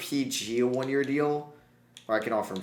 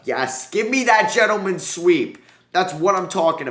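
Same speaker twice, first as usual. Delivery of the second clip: triumphant cheer; 9 semitones higher, 8 dB louder than usual. Real, not acted. Gamer celebrating his sweep to viewers, triumphant cheer. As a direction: savour the sweep out loud.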